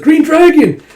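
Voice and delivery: high, loud call across the sky; a desperate hammed-up cry; shout. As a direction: yell his name for help. Zeno calls out to ally.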